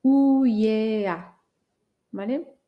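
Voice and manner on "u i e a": U I E A drawn out; U, I, E, A one by one; slow vowel spelling. Shown as a demonstration. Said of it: "he pronunciado suciedad".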